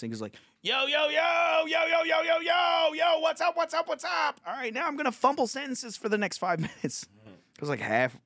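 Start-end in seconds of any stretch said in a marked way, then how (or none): 0.6-4.4 high, drawn-out shouted hype intro; mock an overexcited open-micer greeting the crowd; hyped-up novice comic. Comedian mocking a Flagstaff open-mic beginner, hyped-up shouting. 4.5-7.1 high-pitched mock stage patter; mock novice comic. Comedian mimicking a comic about to fumble his set, high hyped voice.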